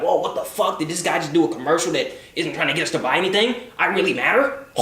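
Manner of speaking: 1 amazed viewer. Pretend. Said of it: "they're gonna be like".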